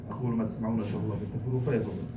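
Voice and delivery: fast, low formulaic recitation; formal Arabic khutba formula. Acted. Imam closing and reopening the sermon, formulaic recitation. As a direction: rush through the ritual formula.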